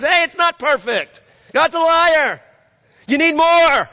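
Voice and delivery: preacher voicing the enemy lying to Adam and Eve; the enemy tempting. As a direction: taunt like the serpent whispering lies. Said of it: "the enemy comes and says".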